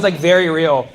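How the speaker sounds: usual speaking voice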